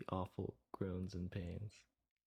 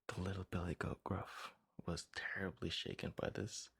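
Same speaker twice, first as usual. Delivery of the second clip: ordinary delivery, nothing marked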